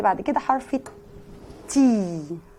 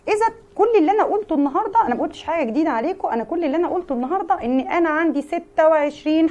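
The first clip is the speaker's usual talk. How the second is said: higher, fast teacher summing up; lively recap; 6 semitones higher than usual. Acted. Teacher wrapping up the lesson for kids. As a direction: rattle off the recap brightly.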